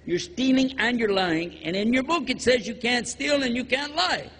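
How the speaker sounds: normal talking voice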